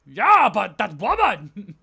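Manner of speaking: loud enraged brute; furious shouting. Acted. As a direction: roar in betrayed fury. Falls raging at Valerie.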